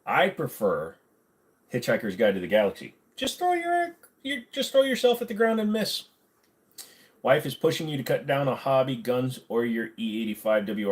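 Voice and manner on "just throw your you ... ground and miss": high put-on voice. Quoting Hitchhiker's Guide.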